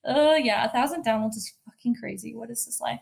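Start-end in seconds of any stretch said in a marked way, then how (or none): none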